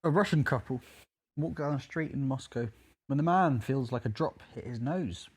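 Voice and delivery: ordinary talk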